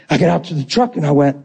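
ordinary talk, nothing marked

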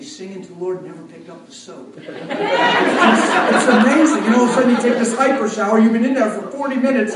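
normal talking voice

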